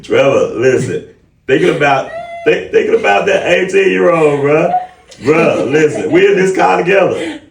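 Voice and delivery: high and loud, laughing as he calls Trevor; rally your friend, all fired up; pumped-up shout to Trevor, high and loud; hyped excitement. Real, not acted. Hyped call-out to co-host Trevor.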